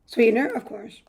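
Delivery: ordinary delivery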